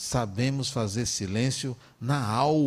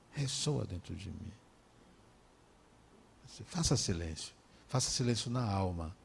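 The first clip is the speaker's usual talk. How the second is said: soft hushed urging; 7 semitones lower, 11 dB quieter than usual. Real, not acted. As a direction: drop to a hush, low and soft. Urging inner silence softly.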